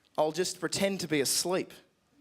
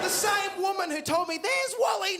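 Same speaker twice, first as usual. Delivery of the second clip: outraged disbelief; 11 semitones higher than usual. Real, not acted. Indignant about mum.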